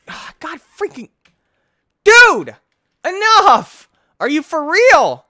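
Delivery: high, very loud outburst; exasperated yelling. Real, not acted. Player fed up with the game, yelling in exasperation. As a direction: yell in sheer frustration, voice shooting up.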